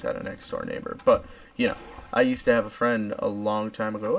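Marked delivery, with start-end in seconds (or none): none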